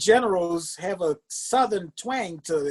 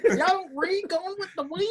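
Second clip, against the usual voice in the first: higher, softer hammed-up Southern accent; 12 semitones higher, 5 dB quieter than usual. Repeats line in mock voice.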